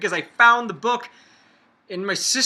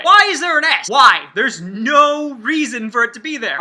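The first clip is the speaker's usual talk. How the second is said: exasperated rant; 4 semitones higher, 7 dB louder than usual. Real, not acted. Ranting about the S in Arkansas.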